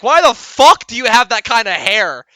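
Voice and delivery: put-on loud, high, blunt child voice firing questions; a parent imitating their child's loud innocent questions; nosy kid. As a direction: play a curious kid blurting rude questions out in public. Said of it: "your kid's like".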